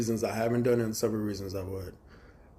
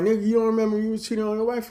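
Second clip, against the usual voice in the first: much higher and fast mock accusation; imitating brother-in-law; 10 semitones higher, 4 dB louder, faster than usual. Drunk brother-in-law accusing him of cheating, fast high jab. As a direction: rattle off the jab quickly.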